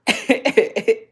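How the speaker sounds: a short, bright real laugh; burst of laughter. Real, not acted. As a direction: laugh out loud at yourself. Laughing after a water break.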